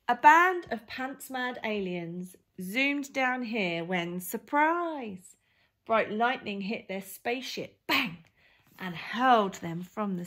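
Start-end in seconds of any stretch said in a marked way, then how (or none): none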